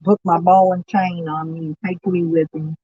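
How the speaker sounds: normal talking voice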